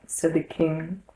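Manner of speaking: ordinary delivery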